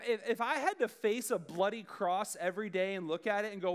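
ordinary talk, nothing marked

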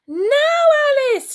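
very high, loud call; urgent shout. A narrator's reading. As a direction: shout her name to wake her. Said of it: "shouted Hamish again".

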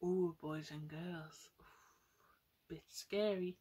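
ordinary delivery, nothing marked

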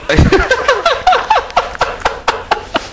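high squeal of nervous laughter; shrieking laugh at the sight. Real, not acted. Looking down at wound.

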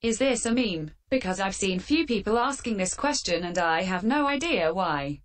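ordinary delivery

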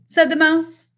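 put-on lively read-aloud for children; storybook reading. A narrator's reading. Teacher reading a picture book to the class, lively storytelling.

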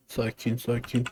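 calm yourself under your breath; hushed, steadying repetition; quiet calming. Real, not acted. Calming down while playing horror game.

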